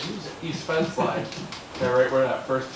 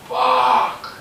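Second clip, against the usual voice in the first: much higher and louder, a yelled swear; shouted curse; 13 semitones higher, 10 dB louder than usual. Retold or imitated. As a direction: yell it out across the field. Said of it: "I hear".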